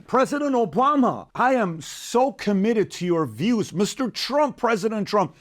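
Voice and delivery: high, drawn-out, hammed-up appeal; overeager flattery. New podcaster courting presidents as guests, mock-fawning. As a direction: call out to famous leaders like a gushing fan.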